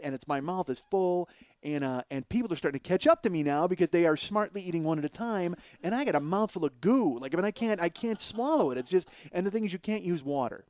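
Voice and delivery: usual speaking voice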